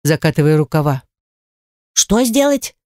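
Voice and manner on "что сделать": high startled squeak; frightened squeak. Acted. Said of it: "испуганно пискнул домовой". (испуганный писк)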